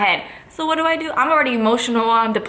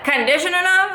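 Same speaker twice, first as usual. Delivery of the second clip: incredulous echo; 9 semitones higher than usual. Retold or imitated. She throws the judge's excuse back, incredulous. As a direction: repeat "Conditioned enough?" like you can't believe it. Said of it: "I'm like".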